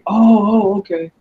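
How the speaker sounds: a high, loud mock "oh" of realisation, then an okay; mild mock surprise as people catch on; imitated realisation. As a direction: act out someone finally getting it. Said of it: "A lot of people are like".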